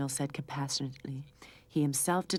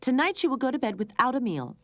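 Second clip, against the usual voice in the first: angry; 8 semitones higher, 5 dB louder than usual. A narrator's reading. Angry mother sets punishment.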